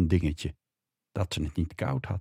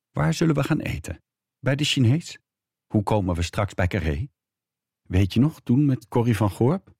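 higher-pitched questions, a touch anxious; nervous small talk; 5 semitones higher, 3 dB louder than usual. A narrator's reading. Woman talks away her fear to her husband, mildly nervous chatter. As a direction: chatter on to cover mild unease. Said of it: "probeerde haar angst en walging weg te praten".

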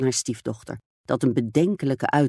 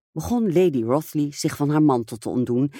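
ordinary delivery, nothing marked

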